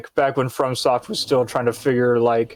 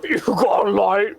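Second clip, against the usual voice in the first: higher mock smoker voice; smoker Patrick; 6 semitones higher than usual. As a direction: ask for a light as a cartoon smoker. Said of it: "Patrick would sound like if he was a smoker".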